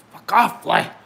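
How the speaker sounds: sudden loud yell at a fly; annoyed shout. Real, not acted. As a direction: snap loudly at a pest.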